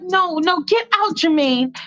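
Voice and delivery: high-pitched flustered protest; panicked Michael. Acted. Michael ordering brother out, high panicked voice. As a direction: shoo him out in a high voice. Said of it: "Michael's like".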